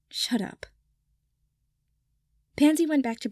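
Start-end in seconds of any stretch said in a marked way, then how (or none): 0.1-0.7 hushed unvoiced retort; whispered. Draco to Pansy, whispered. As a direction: whisper it back, half annoyed.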